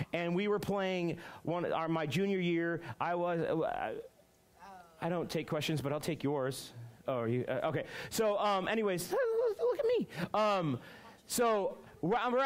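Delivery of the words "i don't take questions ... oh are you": normal talking voice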